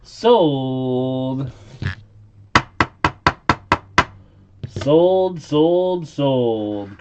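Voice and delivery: loud high sold, sold, sold; auctioneer. Acted. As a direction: bang the gavel with your voice. Auction closing.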